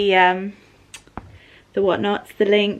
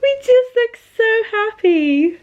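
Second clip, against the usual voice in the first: high, loud, slow tearful wail; real happy-crying voice; emotional happiness; 10 semitones higher, 6 dB louder, slower than usual. Real, not acted. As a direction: overcome with joy, draw it out high. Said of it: "I don't know why I'm getting emotional".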